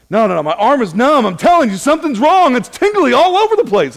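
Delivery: high, loud, rising mock panic; wife insisting she is dying, imitated in a hammed-up panic. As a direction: squeal it like a hammed-up hypochondriac wife. Wife panicking, retold by husband.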